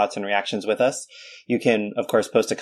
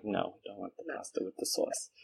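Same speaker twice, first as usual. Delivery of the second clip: put-on far softer New York-accented refusal; 15 dB quieter than usual. Turning down mom's pasta, soft New York accent. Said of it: "do it right when you imitate the accent".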